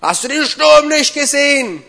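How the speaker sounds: high, loud acted yell; incredulous shout. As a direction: shout the question in utter disbelief. Interpreter mirrors Peter's outburst.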